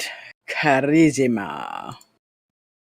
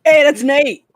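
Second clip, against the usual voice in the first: high, loud whoop of joy; excited cheer; 11 semitones higher, 7 dB louder than usual. Real, not acted. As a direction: celebrate the roll. Celebrating a good roll.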